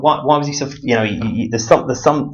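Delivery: ordinary delivery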